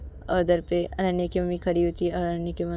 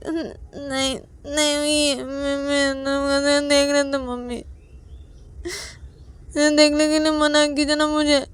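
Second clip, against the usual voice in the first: higher, louder sobbing complaint; tearful child; 9 semitones higher, 7 dB louder, slower than usual. Acted. Payal to mother, crying and accusing. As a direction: tell on her sister through tears. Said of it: "तो, तो पायल".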